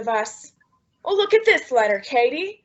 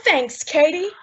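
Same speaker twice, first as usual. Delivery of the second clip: ordinary delivery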